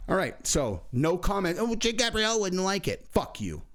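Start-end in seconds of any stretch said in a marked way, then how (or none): 1.6-3.1 high-pitched mock scolding; put-on high voice ridiculing the critic; mocking commenter. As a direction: mimic a fussy complainer, pitched well up. Mocking imagined commenters who scold him.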